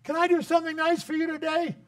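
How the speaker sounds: put-on high, sweet child's voice asking kindly; little girl. Little girl offering an elderly person kindness, high and sweet. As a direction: ask it like an eager little girl. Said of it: "she'd go up to".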